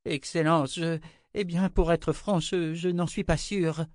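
high, hesitant deferential voice; anxious high-voiced servant; nervous stammering. A narrator's reading. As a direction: falter before your master, pitched high. Servant stammers before angry emperor.

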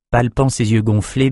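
ordinary delivery, nothing marked

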